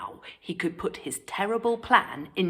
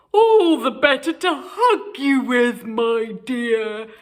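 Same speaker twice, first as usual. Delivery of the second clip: sly wolf voice; 5 semitones higher, 7 dB louder than usual. A narrator's reading. Wolf answers.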